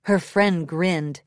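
ordinary delivery

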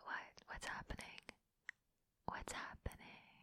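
mock-innocent whisper. Playfully feigning confusion, whispered. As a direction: ask it hushed and coy.

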